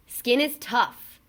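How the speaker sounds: usual speaking voice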